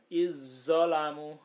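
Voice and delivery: "Izzolamu" chanted slow and loud with a long doubled z; merged za into zo. Shown as a demonstration. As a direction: recite "Izzolamu" holding the heavy z, vowels drawn out.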